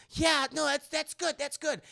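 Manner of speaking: very high put-on woman's voice; mock anxious acceptance; imitated nervous patient. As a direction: agree in a high, hurried squeak. Acting the woman answering.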